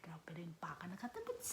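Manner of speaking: whispered invitation to viewers. Real, not acted. Whispering to viewers that they're going hiking. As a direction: lean in and whisper it.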